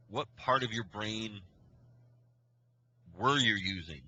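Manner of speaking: deliberate, pausing mid-question; retold scolding. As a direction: let each chunk hang, exasperated retelling. Retold scolding of two boys.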